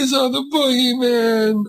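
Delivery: high, slow frightened question; scared child. Acted. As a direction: ask it like a timid little kid. Child asks parents fearfully.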